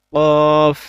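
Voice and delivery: কফ said loud and deep from the throat; deep qaf. Shown as a demonstration. Teacher shows the throat-deep qaf, loud. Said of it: "ভিতর থেকে উচ্চারণ হবে".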